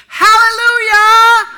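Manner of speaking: loud, high, drawn-out hallelujah; joyful shout. Retold or imitated. Old lady shouting from the shore over the found ring. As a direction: cry it out in triumph.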